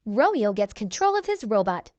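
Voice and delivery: plain reading voice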